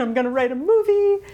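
giddy mock announcement of her own movie; excited daydream. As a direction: replay the big inspiration full of glee. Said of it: "I had the big inspiration".

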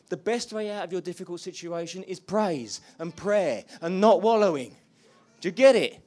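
loud, high, fervent preaching; preaching at full force. Acted. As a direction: lift the room with passionate praise. Preaching climax.

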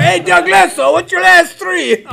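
hammed-up guard yelling the misspelled name; guard calling out. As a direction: bark out the wrong name like a guard at roll call. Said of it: "I'm fighting this guy".

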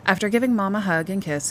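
ordinary delivery, nothing marked

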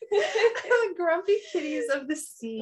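giggling through the words; laughing. Real, not acted. Laughing about grumpy sea turtles, giggling. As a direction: laugh it out, amused.

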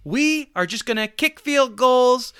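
high mock coach voice. Mocking the team's play-calling in a high voice. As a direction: parody their smug plan. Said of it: "they're saying".

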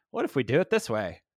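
put-on old newsreel announcer, jumping high on the punchline; hammy vintage radio delivery, astonished at the end; fifties radio voice. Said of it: "you even have to say it in, like, a fifties radio voice".

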